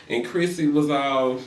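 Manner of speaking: normal talking voice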